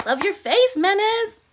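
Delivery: high, loud squeal of affection; bright, high, loud, gushing greeting; excited shoutout. Real, not acted. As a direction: gush at a favourite viewer. Greeting a chat viewer.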